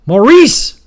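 loud, high bellowed name. Acted. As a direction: president yelling for his aide, full bellow. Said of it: "bellowed President Smith".

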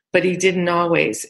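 normal talking voice

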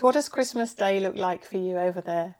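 normal talking voice

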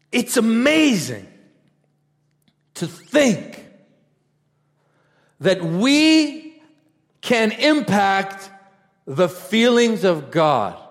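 slow, high, loud proclamation; retold preaching cry. Preacher recalling his words to the crowd, slow loud proclamation. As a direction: draw each phrase out and proclaim it. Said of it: "I said".

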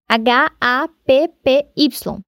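letters spelled slowly one by one; slow spelling. Shown as a demonstration. (soletrando devagar)

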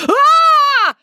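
loud, very high scream; piercing high-pitched shriek. A narrator's reading. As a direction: shriek in sudden fright. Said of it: "screamed Pauline and Sienna".

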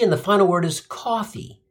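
ordinary talk

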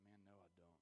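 tearful. Retold or imitated. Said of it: "He's like".

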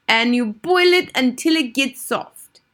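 high, loud, slow witch instruction; imperious Grand High Witch. A narrator's reading. Grand High Witch dictating recipe to witches, imperious. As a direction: spell it out grandly in the witch accent.

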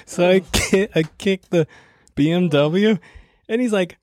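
ordinary talk

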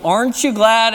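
playful punchline to the congregation; joke punchline. Pretend. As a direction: land the payoff with delight. Delivering knock-knock punchline.

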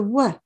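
"w" as a short wuh, not the letter name; isolated letter sound. Shown as a demonstration. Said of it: "You wanna say the sound".

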